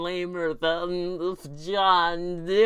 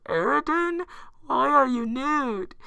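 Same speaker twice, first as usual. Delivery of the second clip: high squeaky Fluttershy; 9 semitones higher, 5 dB louder than usual. Acted. Voicing Fluttershy in the fanfic.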